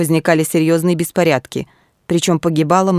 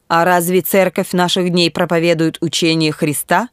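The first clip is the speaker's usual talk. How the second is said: plain reading voice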